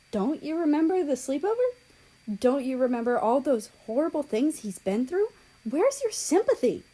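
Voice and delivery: offended. A narrator's reading. Japan scolds South Korea, high and offended. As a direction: sound hurt and affronted. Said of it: "she asked with an offended tone".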